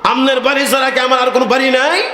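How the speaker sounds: high, quick defiant retort; defiant son. Retold or imitated. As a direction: toss the question back at father fast. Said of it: "এবার ছেলে বলতেছে".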